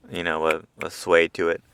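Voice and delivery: ordinary delivery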